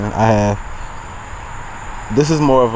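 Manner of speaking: ordinary delivery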